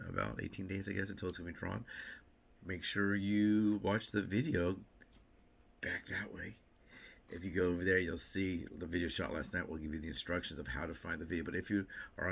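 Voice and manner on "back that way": whispered back that way. Real, not acted. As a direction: drop to a whisper. Whispered aside.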